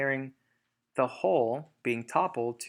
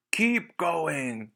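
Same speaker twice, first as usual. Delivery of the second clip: shout; 4 semitones higher, 4 dB louder than usual. Acted. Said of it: "shouted to Bunce and Bean".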